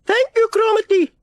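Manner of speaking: very high, loud grandma mishearing; grandma shouts back the garbled message; old grandma. A narrator's reading. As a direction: repeat it back confidently wrong. Grandma ends the call.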